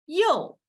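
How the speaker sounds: "yo" dropping sharply; fourth tone yo. Shown as a demonstration. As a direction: snap "yo" down from high. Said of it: "as you change the tone".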